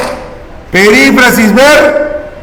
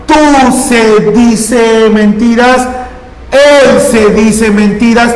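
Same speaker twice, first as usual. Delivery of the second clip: slow conjugation demo; 3 semitones higher, slower than usual. Shown as a demonstration. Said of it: "Porque ahora es yo se dice mentiras".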